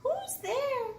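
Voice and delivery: high little mouse calling out; squeaky mouse cry. A narrator's reading. Mouse calls out into the dark den, high and squeaky. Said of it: "Mouse cries".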